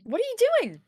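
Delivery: put-on high, loud, anxious questions to the kid; a frightened guardian checking on the child; worried calling. Still alarmed at the child.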